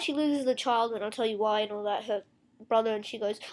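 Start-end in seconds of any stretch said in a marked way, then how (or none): none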